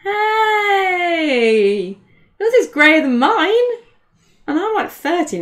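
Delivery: loud, high, drawn-out objection; playfully indignant, pitch way up; mock-offended protest. Real, not acted. Partner teased about her greying hair, playfully indignant.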